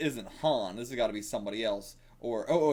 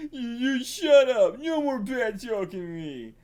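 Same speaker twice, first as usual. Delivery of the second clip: slow, louder, higher troll retort; louder, slow, higher troll scolding the hero; 10 semitones higher, 6 dB louder, slower than usual. Acted. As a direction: shout back like an offended troll. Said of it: "a troll".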